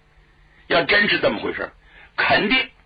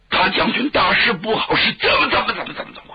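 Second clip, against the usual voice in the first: high, agitated cry of bad news; panicked report; 8 semitones higher, 3 dB louder than usual. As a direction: blurt it out in a stammering acted panic. Frightened informers report urgent danger.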